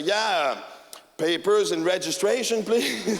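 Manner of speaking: mimicking the cop through laughter; mock him while cracking up; laughing cop imitation. Mocking the cop back with papers and registration, laughing.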